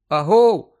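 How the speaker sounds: loud call into the dark house. Acted. Harry calling out into the empty house, loud. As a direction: call out to find who's there. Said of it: "покликав Гаррі".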